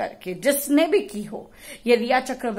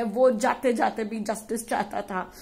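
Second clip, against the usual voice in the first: normal talking voice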